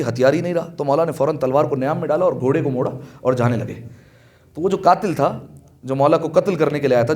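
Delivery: usual speaking voice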